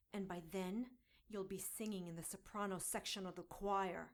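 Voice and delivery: ordinary talk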